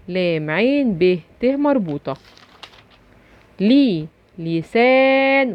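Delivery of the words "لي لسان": لي alone, then لسان slowly with a long held aa; showing the long vowel. Shown as a demonstration. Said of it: "وبين المد". (مد الألف في لسان)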